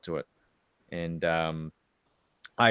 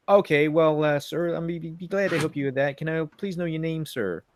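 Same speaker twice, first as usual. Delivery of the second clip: higher-pitched, helpful American airline clerk; imitated American agent; 7 semitones higher than usual. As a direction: mimic an American agent politely offering help. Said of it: "this is how the guy was talking".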